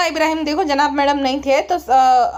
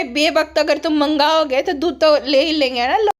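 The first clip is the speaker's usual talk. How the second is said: ordinary talk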